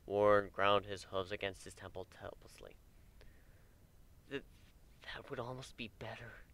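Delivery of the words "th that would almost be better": quiet broken stammered admission; falter, close to tears; admitted, sniffling. A narrator's reading. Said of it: "he admitted, sniffling faintly".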